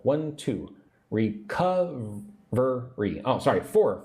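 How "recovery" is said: "Recovery" very slowly, syllable by syllable, stressing CUV; stress on second syllable. Shown as a demonstration. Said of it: "Put the emphasis on the second syllable".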